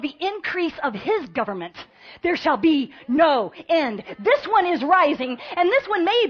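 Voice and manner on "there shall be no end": ordinary delivery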